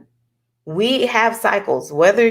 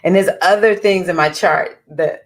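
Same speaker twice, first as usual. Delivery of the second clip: usual speaking voice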